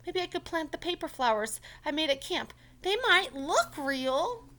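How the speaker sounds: high, loud hopeful character voice, slowing at the end; Franklin's voice. Acted. Franklin proposing paper flowers, high and hopeful. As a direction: float the idea hopefully.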